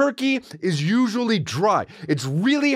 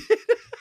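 high burst of laughter; laughing. Real, not acted. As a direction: crack up at your own punchline. Laughs at own punchline.

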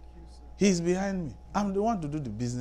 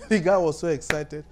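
ordinary delivery

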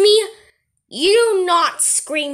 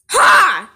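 higher, louder mocking ha; taunting shout ha; 5 semitones higher, 6 dB louder than usual. Kid taunts mom after his threat, shouted ha. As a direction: bark a defiant ha.

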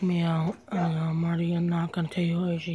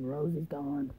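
ordinary talk, nothing marked

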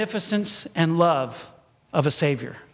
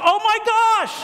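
high-pitched mock cry of shock; hammed-up dismay; alarmed exclamation; 11 semitones higher, 3 dB louder than usual. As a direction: cry out as if the ride just lurched. Acting out alarm on the ride.

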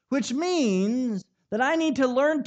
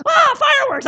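high, loud squeal of delight; thrilled little girl; 11 dB louder than usual. Retold or imitated. Father imitating daughter seeing sparklers, a high thrilled squeal. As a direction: shriek like a toddler waking to sparklers. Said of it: "She goes".

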